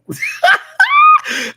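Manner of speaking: loud high shrieking cackle; shrieking laugh. Real, not acted. Telling friends the flirt story, shrieking laugh. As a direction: burst out in a screaming laugh. After stripper's bold line.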